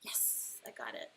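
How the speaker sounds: soft private yes; whisper. Real, not acted. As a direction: whisper the win to yourself. Quietly celebrating a correct guess.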